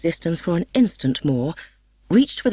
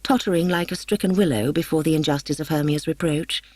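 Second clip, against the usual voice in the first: usual speaking voice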